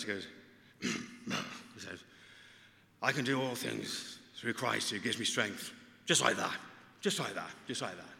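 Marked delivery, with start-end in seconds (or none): 3.0-5.7 lightly voiced Tommy Cooper, gruff and deliberate; a hint of the comedian's delivery; Tommy Cooper impression. Padre retells a camper's Tommy Cooper impression. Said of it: "Tommy Cooper. Tommy Cooper". 6.1-8.1 lightly voiced Tommy Cooper catchphrase, first louder; a hint of the comedian's signature line. Padre imitates Tommy Cooper's catchphrase for the congregation. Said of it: "that's how he used to, to speak".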